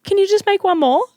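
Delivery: high, loud pleading; whiny customer. Retold or imitated. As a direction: beg in a high voice. Pushy customer after closing.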